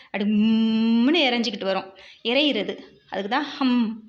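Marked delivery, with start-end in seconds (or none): 0.2-1.3 long held humming buzz; bee's hum. Retold or imitated. Teacher showing children how bees sound, long humming imitation. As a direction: imitate a bee droning on a held M. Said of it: "அதோட சத்தம் கேட்டிருக்கீங்களா".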